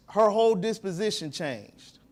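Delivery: usual speaking voice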